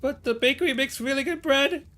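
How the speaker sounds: put-on high, whiny kid pleading; Willy's voice. Willy protesting bread ban.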